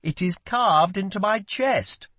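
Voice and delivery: high, mock-indignant prince; teasing royal outrage; playful complaint. A narrator's reading. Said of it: "slipping back into a more playful tone".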